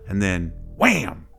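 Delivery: slow, loud punch on wham; sudden burst. A narrator's reading. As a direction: slam the word like a trap snapping. Anglerfish strikes its prey.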